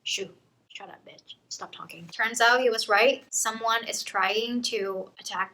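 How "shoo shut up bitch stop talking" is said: much softer hushed shush; hushing. Retold or imitated. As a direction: shut her up under the breath. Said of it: "he tells her to".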